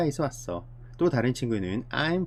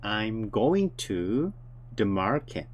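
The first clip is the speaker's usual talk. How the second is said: slow model reading; 2 semitones lower, much slower than usual. Shown as a demonstration. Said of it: "가고 있는 중이다".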